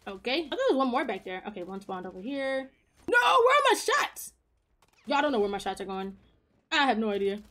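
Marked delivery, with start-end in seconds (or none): 3.1-4.3 high, sudden cry of disbelief; startled frustration. Real, not acted. Streamer to viewers as shots miss, high-pitched disbelief. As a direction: yelp at the missed shots, drawn out.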